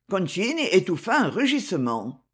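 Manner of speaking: usual speaking voice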